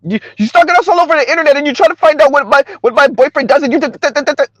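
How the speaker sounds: high mocking rant, breaking into stutters; high-pitched mock accusation that dissolves into babble; Kim Foster. Mocks Kim Foster's complaint.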